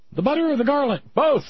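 high-pitched demon cooks trading lines; high play-acted imp voice; little demon. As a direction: play cartoon imps at a cookout. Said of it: "so little demons can barbecue him".

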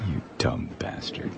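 unvoiced muttered insult; muttered under breath. Real, not acted. Host mutters insult aside.

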